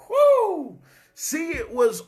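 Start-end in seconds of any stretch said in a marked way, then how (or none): 0.1-0.8 sudden high shout of praise; ecstatic whoop. Real, not acted. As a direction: let out a joyful preacher's whoop. Preacher's ecstatic outburst.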